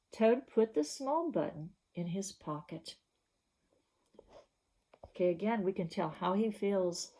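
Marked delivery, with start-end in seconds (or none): none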